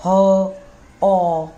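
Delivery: ह and अ drawn out slowly from the throat; throat sounds demo. Shown as a demonstration. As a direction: let ह and अ come straight from the throat, slowly. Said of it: "ये हमारा स्वर यंत्र में आ गया".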